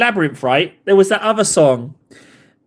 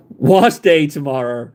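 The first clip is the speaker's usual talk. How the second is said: normal talking voice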